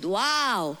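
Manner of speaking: ordinary talk, nothing marked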